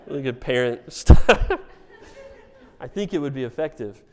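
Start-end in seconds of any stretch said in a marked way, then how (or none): none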